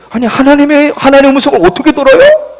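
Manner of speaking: loud, high objection; acted baffled retort; incredulous protest. Retold protest answering God's question.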